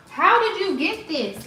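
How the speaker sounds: high, drawn-out disbelieving question; scolding the dog. Real, not acted. Dog owner to her dog over something dug out, scolding. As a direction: demand an answer, pitch swooping high.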